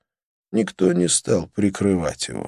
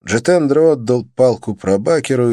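plain reading voice

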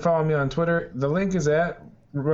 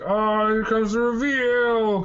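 slow, high, dramatic reveal voice; heavy-handed reveal; 6 semitones higher, slower than usual. Retold or imitated. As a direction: overplay the big reveal. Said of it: "kind of way".

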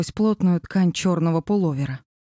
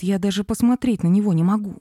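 usual speaking voice

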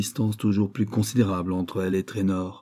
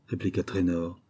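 usual speaking voice

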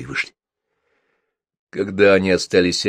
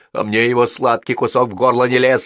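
defiant; 5 semitones higher, 7 dB louder than usual. A narrator's reading. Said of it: "с вызовом сказал Егор".

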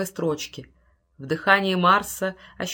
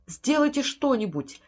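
higher-pitched urgent appeal; desperate plea; 6 semitones higher than usual. A narrator's reading. Field to Bolton as the time link fails, desperate plea.

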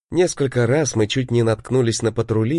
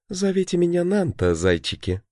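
femme fatale; 9 semitones higher than usual. A narrator's reading. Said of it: "seductive tiger woman".

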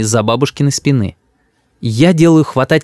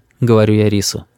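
ordinary delivery

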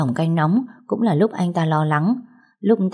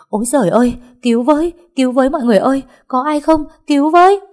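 shrill, high, loud screaming for help, rising to a peak; panicked cry for help; 8 semitones higher, 6 dB louder, faster than usual. A narrator's reading. Maid at the pond screaming for help, frantic loud cry. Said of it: "Giọng chị Oanh kêu lên".

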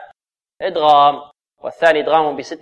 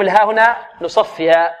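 ordinary talk

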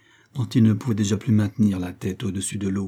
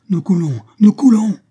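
high cry building to a loud shout; alarmed cry; 9 semitones higher, 10 dB louder than usual. A narrator's reading. Lieutenant warns his companion the canoe is sinking, alarmed shout. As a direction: cry out the alarm, louder and higher the second time. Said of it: "s'écria Jasper Hobson".

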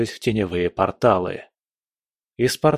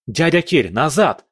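higher, urgent call to get back; shouted warning; 5 semitones higher, 4 dB louder than usual. A narrator's reading. Hero pulls Uncle Kir back from danger, shouted warning. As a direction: call him back sharply, pitch up. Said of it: "крикнул я".

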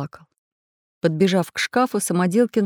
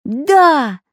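high, loud, drawn-out yes; children answering together; 8 semitones higher, 7 dB louder than usual. A narrator's reading. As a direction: call it out like eager little kids. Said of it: "почти хором ответили малыши".

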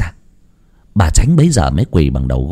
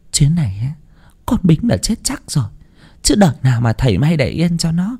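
hushed anxious mother; 8 semitones higher than usual. A narrator's reading. Mother warning her wounded son to hide, hushed anxious voice. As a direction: hush him, fearful and tender.

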